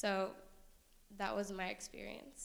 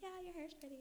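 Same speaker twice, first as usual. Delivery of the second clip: compliment reply; 10 semitones higher, 8 dB quieter, faster than usual. Retold or imitated. Mimicking others' compliment reply.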